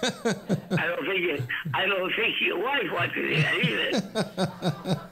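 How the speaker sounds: chuckling while talking; laughing through the words. Real, not acted.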